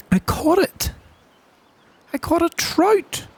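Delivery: very high, squeaky delight; high, disbelieving joy; amazed triumph. Real, not acted. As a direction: squeak it out, thrilled by the catch. Lands the first fish.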